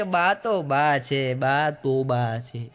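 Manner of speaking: slow, heavy, resigned and low; long-suffering sigh. Retold or imitated. Young wife asked about her mother-in-law, slow resigned sigh. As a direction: drag it out like a tired daughter-in-law.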